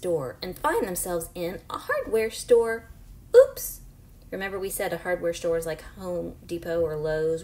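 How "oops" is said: loud, high startled oops; surprised oops. Acted. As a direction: pop it out in surprise. Sudden exclamation in story.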